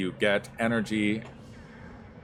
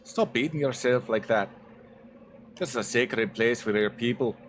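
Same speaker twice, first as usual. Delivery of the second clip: put-on firm Scottish accent, pitched up; 3 semitones higher than usual. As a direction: speak it as a Scottish warrior pleading. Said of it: "please forgive my Scottish".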